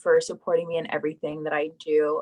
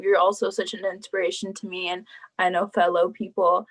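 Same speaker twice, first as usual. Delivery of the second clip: ordinary talk, nothing marked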